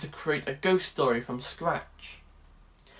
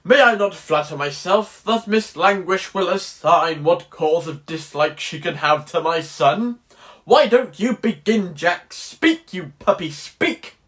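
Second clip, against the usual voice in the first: loud, declaimed period drama voice; old-fashioned stage bluster; seventeen-hundreds speech; 6 dB louder than usual. Acted. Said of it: "in the seventeen hundreds, it's".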